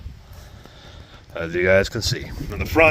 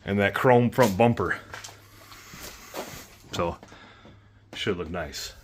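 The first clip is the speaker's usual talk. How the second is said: usual speaking voice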